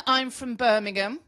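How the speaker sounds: normal talking voice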